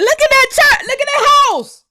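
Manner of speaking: high-pitched astonished exclaim; amazed. Real, not acted. A vlogger stunned by the pastor's house, high and amazed. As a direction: gasp it out in disbelief.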